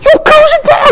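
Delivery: very high mock-crying wail; hammed-up tearful child's shout; imitating bawling child. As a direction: wail it out like a sobbing kid. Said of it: "이러면서 막 우는 거예요".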